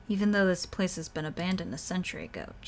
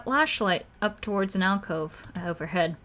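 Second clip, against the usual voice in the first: ordinary delivery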